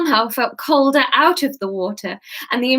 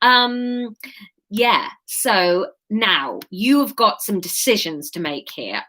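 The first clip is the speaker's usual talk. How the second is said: ordinary delivery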